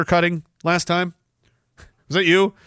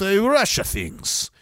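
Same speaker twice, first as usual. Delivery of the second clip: mock Russian accent; 4 dB quieter, slower than usual. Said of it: "If the Russians called you".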